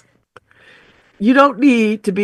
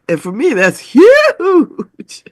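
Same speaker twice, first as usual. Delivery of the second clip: high slow mock wail; mock cry; 8 semitones higher, 5 dB louder, much slower than usual. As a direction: wail it, hammed-up. Mock-wailing about allergies.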